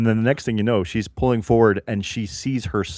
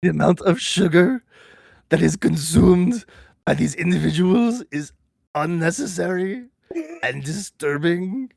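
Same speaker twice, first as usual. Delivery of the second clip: put-on higher, slow, grave German-accented narration; Werner Herzog impression; 8 semitones higher than usual. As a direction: intone it like a solemn German documentary narrator. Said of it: "now I just wanna talk like Werner".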